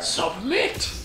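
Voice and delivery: high-pitched cry on a single command; mock villain yell. Imitating Xehanort ordering the player to give in, mock villain yell. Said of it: "pops up and yells".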